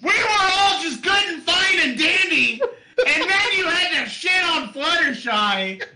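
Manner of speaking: high, slow squealing outrage; shrill fangirl outrage. Acted. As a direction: squeal it like an offended superfan. Outraged squeal at Fluttershy insult.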